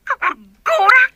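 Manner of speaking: scammed daughter crying to friend on phone. Acted. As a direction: cry out in frustration. Stranded daughter scammed by foreigner.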